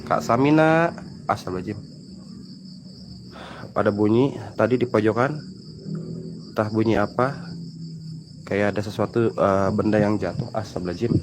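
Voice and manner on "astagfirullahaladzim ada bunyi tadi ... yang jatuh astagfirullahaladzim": low, hushed, spooked voice; hushed and uneasy. Real, not acted. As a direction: whisper it like you heard something. Strange noise in dark corner.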